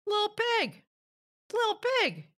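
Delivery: high sing-song call to the pig; high coaxing call of Lil Pig. A narrator's reading. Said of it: "so I called".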